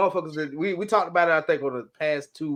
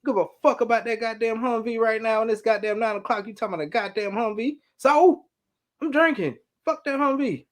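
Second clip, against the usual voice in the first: mock furious rant; 8 semitones higher than usual. To coworkers calling on Saturday, much higher mock furious rant. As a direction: blow up at a Saturday work call. Ranting about weekend work calls.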